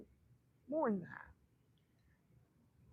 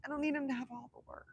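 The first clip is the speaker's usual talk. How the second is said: usual speaking voice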